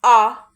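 а called out very loud; loud teacher's model vowel; isolated vowel а. Shown as a demonstration. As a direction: belt the lone а. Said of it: "Друкуємо н".

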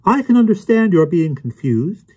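high-pitched, condescending reassurance; smug know-it-all. A narrator's reading. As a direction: sound infuriatingly sure of yourself. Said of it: "smiled in a smug, infuriating, know-it-all way".

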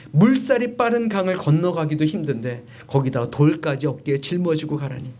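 ordinary talk, nothing marked

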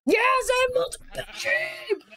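high, bright cheer of triumph; thrilled squeal of victory; excited cheer. Real, not acted. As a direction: celebrate the win out loud. Gets multiple pink sheep.

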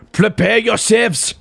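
high, drawn-out warning call; mock battle cry. Player warning enemies before firing, mock battle cry.

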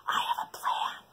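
secret whisper. Acted. Lion whispers to the bear, whisper. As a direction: breathe the plan. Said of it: "The lion whispered to the bear".

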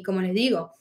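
usual speaking voice

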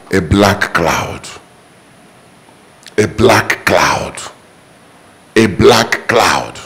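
low, slow and soft; ominous slow repeat. Real, not acted. Preacher naming the curse on the house, slow and low. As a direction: let each word fall heavy and dark.

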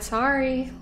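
ordinary talk, nothing marked

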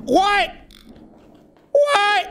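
sudden alarmed squeak of what; startled by floating in the game; startled high what. Real, not acted. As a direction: shoot up high in shock.

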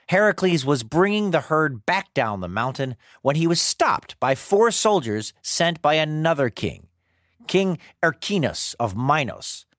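ordinary delivery

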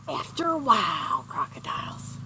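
"After a while" softly put-on, then "crocodiles" whispered; dog's voice fading to whisper. Dog answering his owner's goodbye, fading to a whisper. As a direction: voice the sulky dog, trailing off into a whisper. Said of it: "And Tonka's gonna say".

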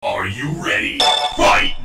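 deep booming round call; booming fighting game announcer. Acted.

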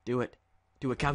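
ordinary talk, nothing marked

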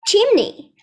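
Chimney called out high and loud; loud model word. Shown as a demonstration. Teacher calls out to her pupils, loud. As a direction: belt out Chimney for the class to repeat. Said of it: "one more time".

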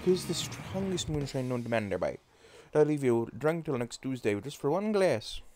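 put-on lively, folksy Newfoundlander accent. Gamer rallying the team and praising moonshine, in Newfoundlander accent. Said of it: "I'm doing a Newfoundlander accent".

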